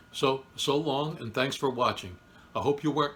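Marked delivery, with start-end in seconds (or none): 0.1-1.2 slow, higher, stretched goodbye; drawn-out farewell. Acted. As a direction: draw out the goodbye.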